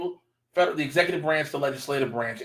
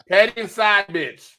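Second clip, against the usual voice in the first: higher and louder, insisting; louder repeat; 7 semitones higher, 5 dB louder than usual. Real, not acted. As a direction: say it again so they hear.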